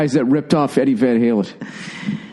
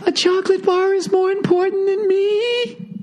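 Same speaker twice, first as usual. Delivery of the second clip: very high, slow wounded whine; high mock self-pity; mock sulking whine; 14 semitones higher, slower than usual. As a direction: pout it out like a hurt kid. Mocking partner's sulk.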